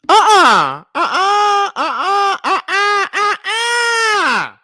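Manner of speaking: high crying shrieks of disbelief; shrieked uh-uh. Real, not acted. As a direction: wail no-no in shock. Reactor reacting in disbelief.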